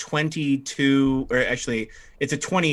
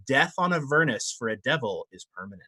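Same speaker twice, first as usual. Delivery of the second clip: normal talking voice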